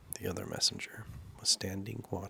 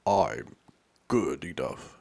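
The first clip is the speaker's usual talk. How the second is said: lower, loud royal reply; a hint of a pompous king; 4 semitones lower, 9 dB louder, slower than usual. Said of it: "the king said".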